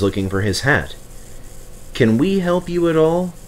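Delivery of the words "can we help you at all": sweetly. A narrator's reading. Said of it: "asked Duck and Stepney sweetly".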